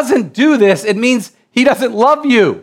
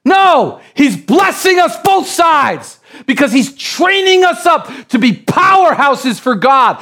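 preaching shout; 7 semitones higher than usual. Real, not acted. Preacher correcting the congregation, shouted. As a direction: shout it to the back row.

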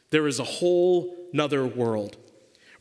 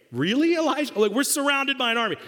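incredulous servant; 7 semitones higher, faster than usual. Acted. Servant's disbelieving reply.

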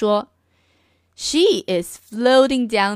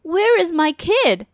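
higher, mildly anxious dad; a father asking the librarian for his kid; lightly voiced worried father; 6 semitones higher, 3 dB louder than usual. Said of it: "and said".

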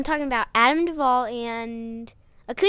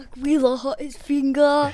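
loud mock Austrian accent; Schwarzenegger; 3 semitones higher, 6 dB louder than usual. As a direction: put on a thick Austrian action-star accent. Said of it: "saying it like Schwarzenegger".

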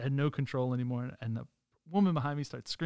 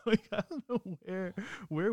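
laughing; 7 semitones higher than usual. Real, not acted. Laughing at the unexplained escape.

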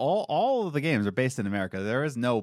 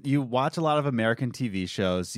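ordinary delivery

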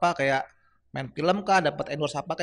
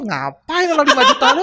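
high, loud, laughing objection; mock-outraged objection; 10 semitones higher, 7 dB louder than usual. Guest retells objecting to the interview fee, laughing mock outrage. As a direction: protest in amused disbelief.

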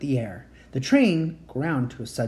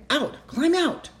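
urgent cry; 12 semitones higher, slower than usual. A narrator's reading. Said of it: "she cried".

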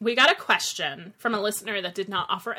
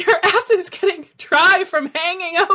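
laughing; 8 semitones higher, 8 dB louder than usual. Retold or imitated. Retold panicky monologue, laughing.

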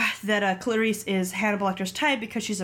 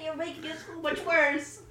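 trying not to laugh; 6 semitones higher, 4 dB quieter than usual. Real, not acted. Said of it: "Stop making me laugh".

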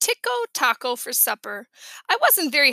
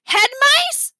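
put-on high, loud, rising kid's question; startled question; 12 semitones higher, 7 dB louder than usual. A narrator's reading. Little brother mishearing Dad, startled. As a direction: squeal it back loudly as a baffled child.

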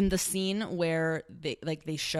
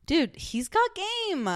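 hyped up; 13 semitones higher, slower than usual. Acted. Hyped praise of Matt.

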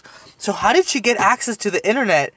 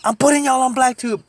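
higher mock threat at the car window; drunk lady threat; 7 semitones higher than usual. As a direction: shout it at the window. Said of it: "she's like".

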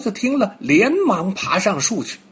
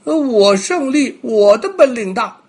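high, louder bragging insistence; boastful deer; 8 semitones higher, 5 dB louder than usual. Acted. Deer argues back at the monkey, high and boastful. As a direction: puff up and claim the win. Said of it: "梅花鹿说".

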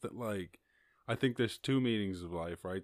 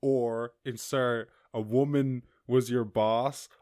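normal talking voice

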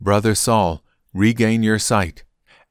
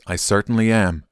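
usual speaking voice

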